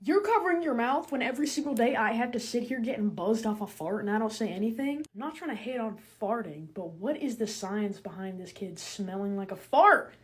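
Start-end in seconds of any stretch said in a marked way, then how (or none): none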